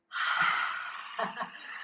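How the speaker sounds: long, forceful voiceless exhale; roaring breath with no voice; lion's breath. Shown as a demonstration. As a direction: demonstrate a big, open-throated lion's breath out. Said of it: "lion's breath".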